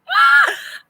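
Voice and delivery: sharp, high, loud gasp of delight; excited gasp. Retold or imitated. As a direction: gasp as if you found treasure. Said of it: "And I'm like".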